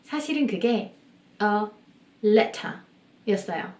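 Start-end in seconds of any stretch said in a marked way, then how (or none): none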